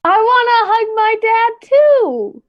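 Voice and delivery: high, drawn-out mock whine; slow, high pouty wail; childlike pleading. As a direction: plead like a kid left out. Player acts character pleading for hug.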